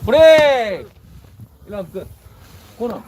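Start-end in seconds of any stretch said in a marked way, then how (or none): 0.1-0.9 sharp loud referee command; loud shout. Real, not acted. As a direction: yell break to split them. Referee separates the fighters.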